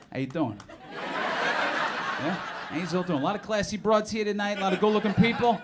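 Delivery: a hint of a greasy New Jersey Italian; lightly voiced Jersey guy greeting the crowd; New Jersey accent. Comedian greeting the crowd as a Jersey Italian, light New Jersey accent. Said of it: "greasy Italians from New Jersey".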